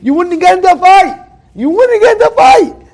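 loud, high-pitched exclamation; emphatic shout. Real, not acted. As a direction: drive the point home at high pitch and volume. Speaker drives home his point.

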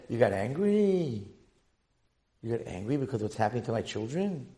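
low, softened questioning; quiet wonder. Acted. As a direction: ask it softly, letting it sink in. God's quiet reply to the fighters.

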